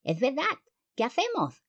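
high lisping clown moth; polilla payaso curiosa. A narrator's reading. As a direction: ask high, with a babyish lisp. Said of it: "quiso saber otra". (pregunta aguda y ceceante)

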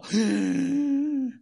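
sharp offended gasp; shocked gasp. Retold or imitated. Offended believer gasps at being told no. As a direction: gasp as if insulted.

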